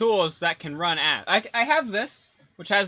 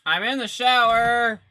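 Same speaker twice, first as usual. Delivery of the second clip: louder, slow shouted call; shouting back; 4 semitones higher, 5 dB louder, slower than usual. Pretend. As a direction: yell it across the house. Shouting back from pretend shower.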